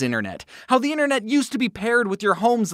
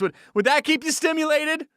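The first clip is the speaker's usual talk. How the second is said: usual speaking voice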